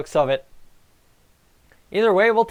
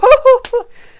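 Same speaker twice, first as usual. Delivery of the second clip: laugh; 11 dB louder than usual. Real, not acted. Laugh after goal.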